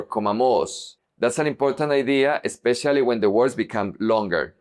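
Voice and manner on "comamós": "comamos" wrongly stressed at the end, co-ma-MOS; wrong final stress. Shown as a demonstration. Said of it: "not comamos or comamos".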